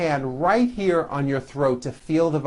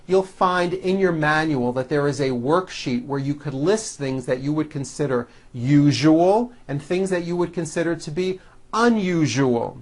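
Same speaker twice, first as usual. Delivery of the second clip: normal talking voice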